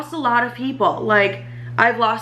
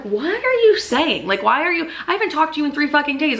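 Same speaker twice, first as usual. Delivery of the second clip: retold incredulous pushback, pitched higher; indignant disbelief; 6 semitones higher than usual. Confronting new man after three days of silence, indignant.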